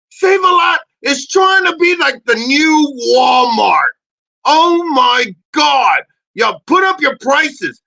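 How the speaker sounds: loud, slow, exasperated complaint; loud fed-up rant at a grocery chain; angry rant. Real, not acted. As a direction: shout it out like a fed-up shopper. Ranting about store prices.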